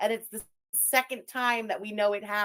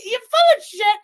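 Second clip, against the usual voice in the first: much higher and louder, real scorn; angry outburst; 11 semitones higher, 11 dB louder than usual. Real, not acted. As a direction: blast the insult at her. Heated online feud, insulting rival.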